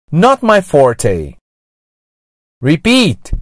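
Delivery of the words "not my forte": slow Not my forte with forte as "for-tay"; slow, clear Not my forte, gringo-style forte; gringo accent. Shown as a demonstration. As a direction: model Not my forte in English, forte ending in "tay". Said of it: "pronunciado com sotaque gringo".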